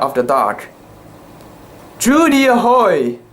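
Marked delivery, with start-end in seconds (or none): 2.0-3.2 lost man howling for his ship; hailing call. A narrator's reading. Doleful voice howling from dock.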